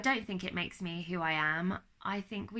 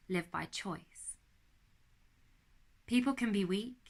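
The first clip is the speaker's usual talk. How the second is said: poem reading. Acted. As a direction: deliver it like a personal manifesto. Reads her own motivational poem.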